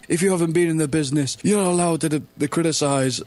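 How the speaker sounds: high, sneering parody of a pro wrestler; mock wrestler scolding. Fan mocks wrestlers who say outsiders can't criticize, high sneering parody. Mocking wrestlers' stock line.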